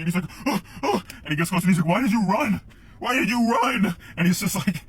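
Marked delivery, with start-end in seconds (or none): 0.5-1.0 high, loud imitated panting; mock winded gasps. Friend catching up after the chase, mock winded gasps. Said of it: "my friend finally starts catching up with me". 1.9-4.0 high, loud panicked shout; freaking out. Retold or imitated. Friend confronting the runaway, freaking out. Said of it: "freaking out".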